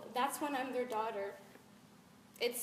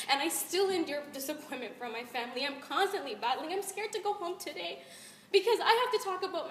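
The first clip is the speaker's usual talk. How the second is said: higher, louder, pained plea; impassioned spoken word; 5 semitones higher, 6 dB louder than usual. Acted. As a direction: pour out the hurt to a crowd.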